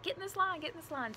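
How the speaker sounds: high-pitched mock directing voice; imitating hurried stranger. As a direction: quote a man waving people along. Voices man ordering them into line.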